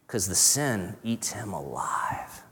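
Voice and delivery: slow hushed whisper. Acted. Preacher whispers to the congregation how sin eats him, slow whisper. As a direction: let it creep out in a whisper. Dark point about guilt.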